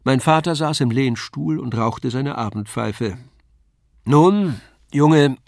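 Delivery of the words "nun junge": louder, higher call to his son. A narrator's reading. Father calls out loudly to his son after the puppet show. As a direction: call the boy over, hearty. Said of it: "rief er".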